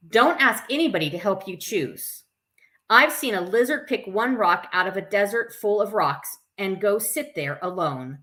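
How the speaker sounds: plain reading voice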